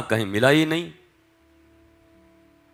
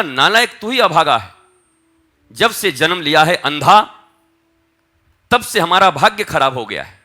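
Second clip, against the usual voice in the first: loud, raised storyteller's rebuke; angry scolding; 6 semitones higher, 8 dB louder than usual. Retold or imitated. Parents blaming their blind son for ruined fortunes, angry scolding. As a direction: act out a parent berating a child. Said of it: "और झिड़क कर माता पिता ने".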